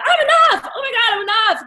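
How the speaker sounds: high, loud, laughing through tears; high tearful breakthrough cry; crying out in relief. Retold or imitated. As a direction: weep with joyful relief. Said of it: "I was crying".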